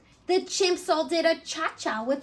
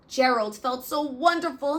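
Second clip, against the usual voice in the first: usual speaking voice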